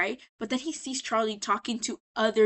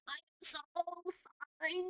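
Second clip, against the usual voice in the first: soft, higher, small voice; mock meek apology; 7 semitones higher, 9 dB quieter than usual. [name] after the studio fire, mock meek. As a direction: imitate a guilty little squeak of apology.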